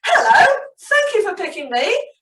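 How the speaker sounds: lightly voiced happy sunflower; Sunny Sunflower voice. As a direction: play a perky little sunflower. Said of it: "said the sunflower".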